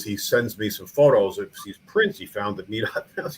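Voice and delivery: ordinary talk, nothing marked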